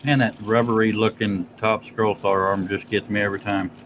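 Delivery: ordinary talk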